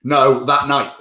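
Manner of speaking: usual speaking voice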